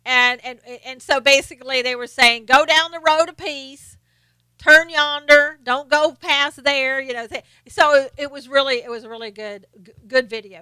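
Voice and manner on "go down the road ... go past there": slow, louder Southern drawl; mock Southern drawl giving directions. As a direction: draw out turn yonder like a country local. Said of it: "in the South sounds like".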